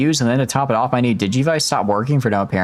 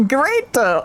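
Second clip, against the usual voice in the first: laughing; 12 semitones higher than usual. Real, not acted. Still laughing at the line.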